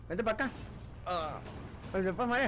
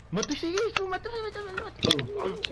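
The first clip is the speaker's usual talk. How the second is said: excited urging; 12 semitones higher than usual. Real, not acted. Urging kill in game.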